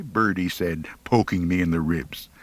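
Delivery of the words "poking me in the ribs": ordinary delivery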